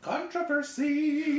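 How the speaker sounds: slow, drawn-out high announcer cry; stretched-out announcer bellow; mock announcer. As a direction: call it like a hyped ring announcer.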